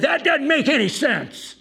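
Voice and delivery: high, loud mock outrage; acted-out exasperated retort; retold indignant shout. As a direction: yell back as the offended host.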